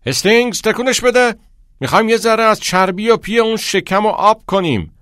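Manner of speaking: high, very loud shouted drill order; coach yelling. A narrator's reading. Coach goads a lagging runner, yelling. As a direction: holler at a runner to get moving.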